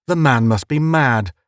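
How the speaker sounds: ordinary delivery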